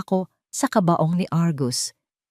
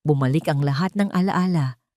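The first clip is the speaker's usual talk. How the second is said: ordinary delivery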